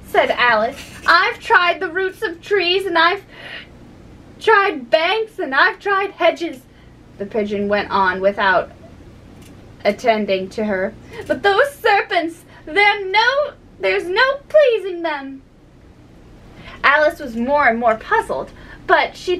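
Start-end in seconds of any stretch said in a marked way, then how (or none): 1.0-6.6 high-pitched, exasperated bird voice; plaintive pigeon listing grievances; fretful pigeon. Acted. 11.3-15.4 high-pitched, exasperated bird voice; plaintive pigeon listing grievances; fretful pigeon. Acted.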